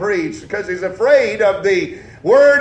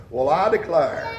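put-on old-timer "well I declare"; 5 semitones lower, 4 dB quieter than usual. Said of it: "the old time folks around whom I was raised".

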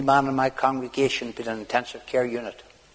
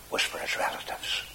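whisper; 8 dB quieter than usual. Acted.

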